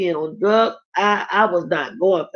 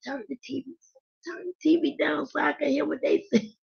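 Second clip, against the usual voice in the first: put-on higher, much softer voice of a nosy child; a lightly voiced little girl, softly pleading; hushed kid plea; 7 semitones higher, 9 dB quieter, faster than usual. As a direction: beg under your breath so mama won't hear. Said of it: "I used to be like".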